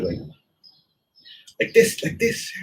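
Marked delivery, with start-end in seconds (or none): 1.6-2.5 high, pinched mimicry of an old teacher; imitating my teacher. Teacher showing a student the form, mock high voice. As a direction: squeak it out like your old master correcting you. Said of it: "even some of my teachers".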